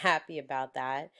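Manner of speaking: plain reading voice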